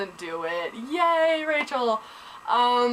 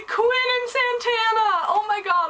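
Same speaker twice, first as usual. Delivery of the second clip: names cried out much higher and louder; excited squeal; 12 semitones higher, 3 dB louder than usual. Real, not acted. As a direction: burst out thrilled to see them back. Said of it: "I was so excited".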